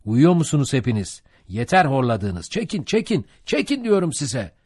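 exasperated, climbing high; heated mate's order, pitched high by the end; scolding urging. A narrator's reading. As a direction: rouse the sleepy rowers with rising urgency. Mate scolding sluggish rowers.

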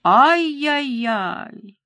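high, very drawn-out tut-tutting; mock scolding. A narrator's reading. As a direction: chide mockingly, stretching each syllable. Killer mockingly scolds the captive's swearing. (насмешливо, с укором, нараспев)

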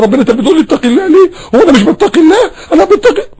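hammed-up indignant complaint; mock offended protest. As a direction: whine like someone insulted. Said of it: "زعل النبي وقال".

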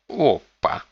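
put-on high pouty girl's call; whiny spoiled pouty drawl. A narrator's reading. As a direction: whine it like a spoiled girl. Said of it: "капризным голосом ответила девушка".

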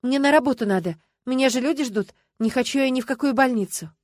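protesting. A narrator's reading. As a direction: plead your way out of hospital. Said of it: "запротестовала Люба".

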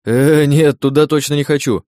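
alarmed. Acted. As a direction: protest in sudden alarm. Sliding toward the toothy maw.

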